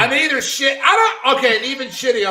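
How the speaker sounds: a sudden loud, high, flustered yelp; flustered outburst. Real, not acted. Put on the spot for the accent, he yelps loudly. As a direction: caught off guard and protesting loudly.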